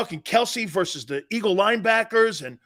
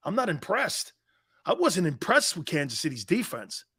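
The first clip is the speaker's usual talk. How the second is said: ordinary delivery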